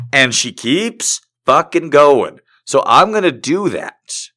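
ordinary talk, nothing marked

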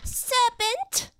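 high, loud pigeon shriek; scream. Acted. Pigeon attacking Alice in the treetops, screaming. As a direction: screech it as an outraged alarm. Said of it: "screamed the pigeon".

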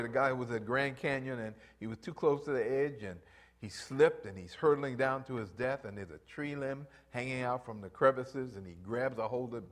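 ordinary talk

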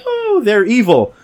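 high, slow scandalized voice; mock outraged voice. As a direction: act scandalized, drawn out. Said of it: "people are like".

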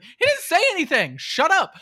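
high, loud mock outburst; exasperated retort. Viewer snapping back at the movie heroine, exasperated. Acted retort to the film character.

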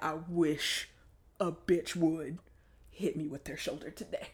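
low, soft, simmering threat; quiet menace. Acted. To whoever might shoulder her, quietly menacing. Seething wish someone would bump her.

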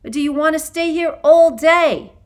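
loud, high scolding yell; angry shout. A narrator's reading. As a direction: shout at the dawdling class. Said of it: "she barked".

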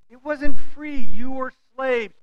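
loud, slow insistence on slavery; emphatic rebuke. Real, not acted. Preacher rebuking the Israelites, loud and slow. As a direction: drive home that it was never free.

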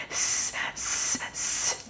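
soft hissing s, like a snake; long, whispered snake s. Shown as a demonstration. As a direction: hiss out a quiet s. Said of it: "sounds like a snake".

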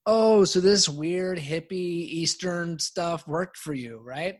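slow, loud mock-teasing drawl on the first part; a friend ribbing him about hippie stuff, half-mocking. Friend teasing about hippie meditation, slow and loud.

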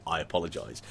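low, soft, mock grown-up "I apologise"; clipped formal apology. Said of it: "he's saying".

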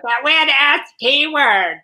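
high, slow mocking caricature; mock him in a squeaky, drawn-out high voice; exaggerated high-pitched imitation, stretching the words; Ben Shapiro impression. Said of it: "He did it more like that".

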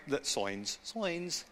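slipped into an Aussie accent; accidental Australian twang. Real, not acted. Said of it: "going into my Australian accent".

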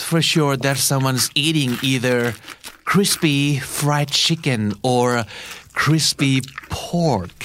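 very slow, spelled-out phrasing; slow model sentence. Shown as a demonstration. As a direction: stretch each word for the class. (พูดช้าๆ ให้ผู้เรียนฟังทีละคำ)

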